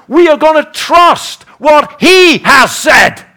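loud, high, building to a shout; emphatic rallying cry, hammering the last words; rousing exhortation. Acted.

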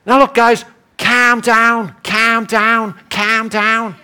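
mock Scouse pacifier, repeating the phrase; Liverpudlian accent. Peter to the guys bailing out, in Scouse accent. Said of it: "he should have been saying to the guys".

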